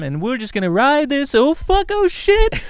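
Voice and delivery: high, loud panicked cry; mock panic. Sailors crashing in a big wave, high mock panic. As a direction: yelp as the wave hits.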